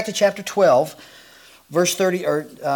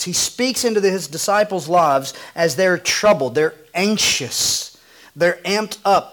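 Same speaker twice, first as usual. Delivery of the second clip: ordinary talk, nothing marked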